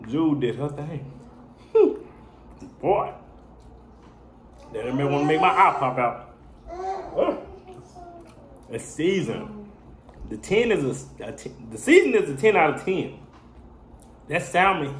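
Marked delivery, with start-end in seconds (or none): 0.1-11.3 talking while chewing; chewing food. Real, not acted. Seen 1: Eats from tray, fork to mouth at 3.3s, chewing between phrases. 11.3-13.2 chewing while talking; talking between bites; mouth full. Real, not acted. Seen 2: Bite taken before; lips pursed and moving between words, food in hand.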